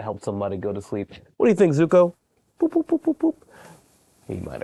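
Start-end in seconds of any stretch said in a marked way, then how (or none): 1.4-2.1 pet voice. Acted. Asking the dog, petting voice. 2.6-3.4 high squeaky boop boop boop; tiny squeaky boops; playful boops. Acted. Booping the dog's nose.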